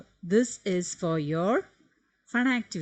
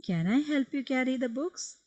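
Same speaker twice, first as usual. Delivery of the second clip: raised, bright asking voice; acted polite offer; 7 semitones higher than usual. Shown as a demonstration. Teacher voicing a child politely offering to carry books, lifted pitch. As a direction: voice the student offering help, lifted.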